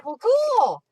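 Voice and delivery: high, loud burst of approval; excited. Real, not acted. Crafter hearing a viewer tried stamping, excited. Delighted by viewer's painting report.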